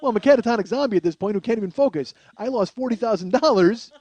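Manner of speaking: loud, fast, high frenzy; frazzled outburst. Real, not acted. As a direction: sound like a sleepless wreck. Manic climax of story.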